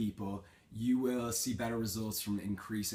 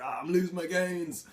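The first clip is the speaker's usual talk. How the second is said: panicked, higher and louder; mock panic, pitched up and loud; freaking out; 8 semitones higher, 5 dB louder than usual. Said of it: "like, freaking out".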